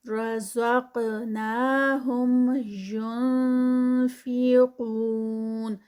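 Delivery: رزقناہم ینفقون chanted slowly with nasal اخفا on نون; slow Quranic recitation. Shown as a demonstration. Teacher recites for students, slow chant. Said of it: "یون پہ یہاں پر اخفا ہے".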